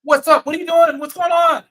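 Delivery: high, loud Pacino greeting; shouted pal greeting, way up high. Retold or imitated. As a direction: belt it out like a buddy calling across the room. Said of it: "walks up to me, goes".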